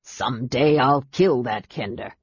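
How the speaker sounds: low grumbling dwarf; muttering. A narrator's reading. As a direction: mutter a threat into your beard. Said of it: "the dwarf muttered into his beard".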